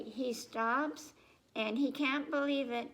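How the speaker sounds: normal talking voice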